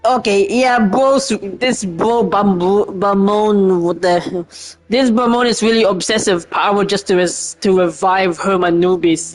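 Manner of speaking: normal talking voice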